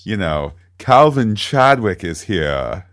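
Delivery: slow, lightly Mid-Atlantic delivery; a hint of old Hollywood Mid-Atlantic; Mid-Atlantic accent. Shown as a demonstration. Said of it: "see, it's easy".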